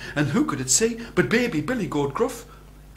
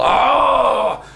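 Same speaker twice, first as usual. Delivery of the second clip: loud roar opening into a challenge; big, booming storybook troll; terrible troll; 3 semitones higher, 7 dB louder than usual. A narrator's reading. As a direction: burst out at the trespasser. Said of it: "the great and the terrible troll".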